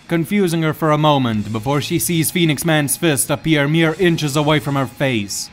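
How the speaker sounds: ordinary delivery, nothing marked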